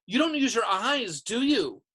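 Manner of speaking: high, loud, scornful snap; snapping. A narrator's reading. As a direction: tell them off for being unobservant. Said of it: "she snapped".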